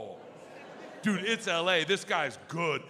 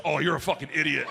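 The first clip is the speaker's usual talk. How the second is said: fast, exasperated dad jab; father snapping at son, lower and quick; dad scolding; 7 semitones lower, 5 dB louder, faster than usual. Retold or imitated. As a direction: rattle off the insult. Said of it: "and goes".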